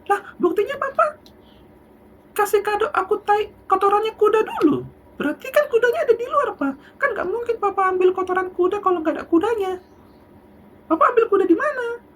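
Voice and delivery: put-on very high, loud delighted kid reasoning; high, loud childish excitement; gleeful child arguing. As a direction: crow it with a child's laughing logic.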